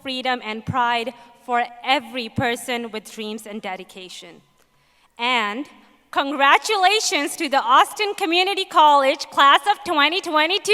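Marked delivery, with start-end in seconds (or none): 6.1-10.7 loud and high, cheering the graduates; rally the class with a proud cheer; excited cheer. Real, not acted.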